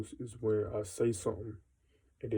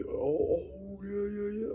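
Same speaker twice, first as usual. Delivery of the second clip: much higher mock "oh, yeah, yeah", fake enthusiasm; mocking copycat; 9 semitones higher, slower than usual. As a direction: ham up a fake eager agreement, pitched way up.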